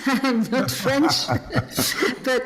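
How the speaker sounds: laughing it off, slow. Real, not acted. Guest laughs off being mistaken for French. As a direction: chuckle through a playful denial. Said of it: "for all of you who think I have a French accent".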